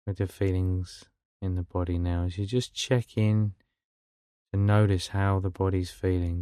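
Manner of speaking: calm, unhurried meditation guide with long pauses; guided meditation. Real, not acted. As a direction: ease them gently into stillness, phrase by phrase.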